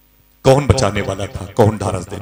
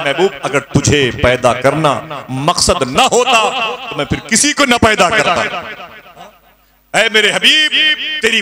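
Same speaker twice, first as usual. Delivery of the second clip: high, loud, ringing proclamation; exalted preaching; 9 semitones higher, 4 dB louder than usual. Acted. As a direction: lift it high with laughing joy. Voicing God's words, preaching.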